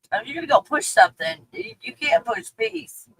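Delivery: ordinary talk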